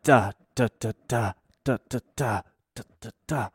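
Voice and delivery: soft staccato "da" strum pattern; imitated strumming rhythm. Guitar teacher showing pupil a rhythm, imitated strumming. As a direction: mouth a guitar rhythm softly in short beats. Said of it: "he gave me some fucking rhythm".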